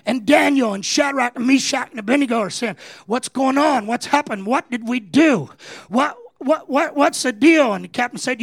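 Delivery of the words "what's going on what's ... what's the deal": bewildered panic. Acted. Daniel dragged from bed.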